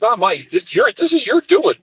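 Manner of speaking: exasperated protest. Retold or imitated. Retold quarrel, shifting blame back.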